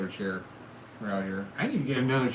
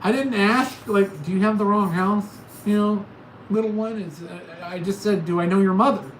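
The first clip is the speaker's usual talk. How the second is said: excited retelling; 6 semitones higher, 8 dB louder than usual. Retold or imitated.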